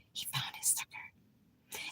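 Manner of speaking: barely audible, slow unvoiced whisper; faint whisper. Repeating to viewers about her landlord, barely whispered. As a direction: breathe it out almost silently, slowly. Repeating the aside even softer.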